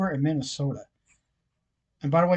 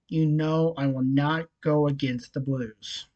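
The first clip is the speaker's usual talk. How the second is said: usual speaking voice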